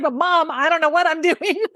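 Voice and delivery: high, laughing protest to mom; laughing reply. Retold or imitated. Daughter answering tearful mom, laughing and high. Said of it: "I'm like".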